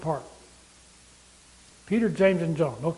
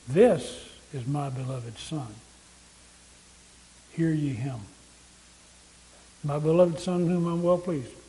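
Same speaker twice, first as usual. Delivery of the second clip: voice from heaven; 4 semitones lower, 3 dB quieter than usual. Acted. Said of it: "A voice came from heaven and said".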